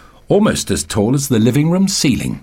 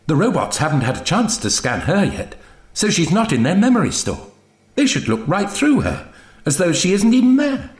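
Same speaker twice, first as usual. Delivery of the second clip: high voice climbing as the argument builds; eager Zoe; 7 semitones higher than usual. Acted. Zoe persuades the Doctor about Betty, building excitedly. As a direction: argue it eagerly, rising higher.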